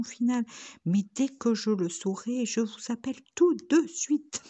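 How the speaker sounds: high, loud, slow and pompous; mocking the director's formal speech; bad imitation of the director. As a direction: mimic a stiff official badly on purpose. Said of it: "j'essaie de l'imiter, mais je l'imite très mal".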